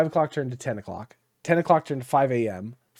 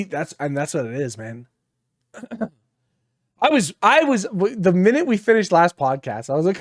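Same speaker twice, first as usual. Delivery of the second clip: ordinary talk, nothing marked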